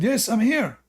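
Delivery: high, quick meek wife voice. Acted. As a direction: answer sweetly like an obedient wife. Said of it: "She said".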